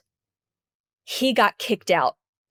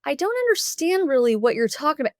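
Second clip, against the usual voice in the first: high, gentle questioning; kind counselor voice; 7 semitones higher than usual. Retold or imitated. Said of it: "She says".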